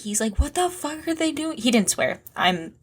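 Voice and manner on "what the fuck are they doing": high-pitched mock whining; Charles, whiny. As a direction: imitate a whiny radio complaint, pitched up. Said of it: "really whiny about it".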